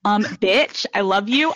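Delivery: ordinary talk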